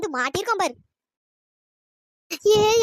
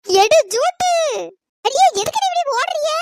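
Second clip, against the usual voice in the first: panicked shout; 6 semitones higher, 7 dB louder than usual. Acted. Running away shouting.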